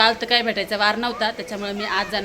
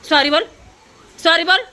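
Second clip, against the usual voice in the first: louder insistent say sorry; firm demand; 5 semitones higher, 6 dB louder than usual. Real, not acted. Mother telling the girl to apologise, firm and louder.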